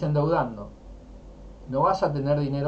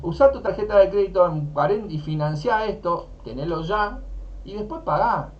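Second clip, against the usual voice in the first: mock credit ad; 6 semitones higher, 4 dB louder than usual. Imitating credit ad message.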